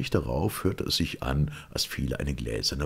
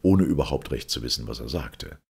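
usual speaking voice

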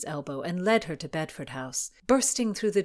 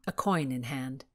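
plain reading voice

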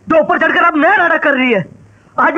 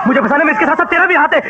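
ordinary delivery

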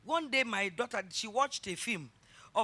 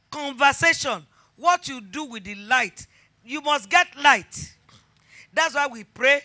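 loud, higher sermon climax; fervent preaching; 3 semitones higher, 11 dB louder, slower than usual. Acted. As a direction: preach it with fire. Preaching at force.

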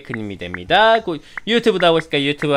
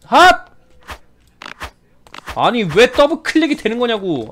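raging gamer; 5 semitones higher than usual. Real, not acted. Double click frustration.

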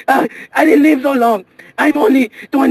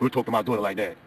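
much lower, stern dad; deep father voice; 13 dB quieter, faster than usual. Acted. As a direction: voice a protective father. Another passenger voice.

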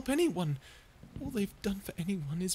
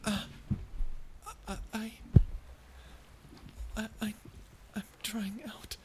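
dry, breathless whisper from thirst; parched whisper. Acted. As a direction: rasp it out, throat bone dry. Parched stranger begging for water.

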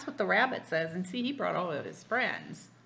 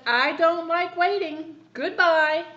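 loud, higher, slow huffy goodbye; loud drawn-out complaint; huffy bird; 8 semitones higher, 10 dB louder, slower than usual. Acted. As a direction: leave in a huff.

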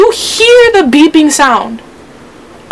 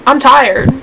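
normal talking voice

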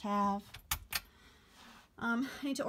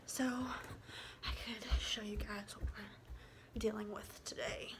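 whispering; 7 dB quieter, much slower than usual. Real, not acted. Hushed aside while filming at home.

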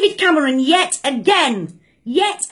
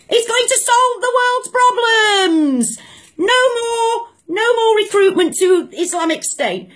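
high, slow mocking sarcasm; mock-naive higher voice; sarcastic; 6 semitones higher than usual. Real, not acted. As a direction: ridicule the idea, drawn out. Mocking the PM's logic.

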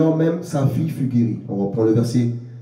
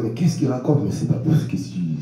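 imagined sceptical listener; 5 semitones lower, 3 dB quieter, faster than usual. Acted. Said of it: "vous allez me dire".

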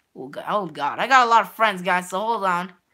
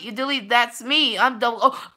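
usual speaking voice

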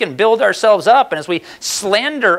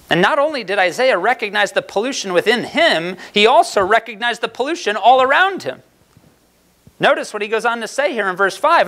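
ordinary delivery

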